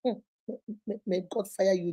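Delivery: normal talking voice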